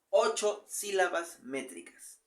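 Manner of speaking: ordinary talk, nothing marked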